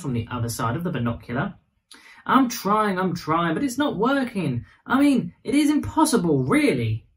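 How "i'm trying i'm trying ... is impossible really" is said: put-on serious child voice. A narrator's reading. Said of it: "Vera replied seriously".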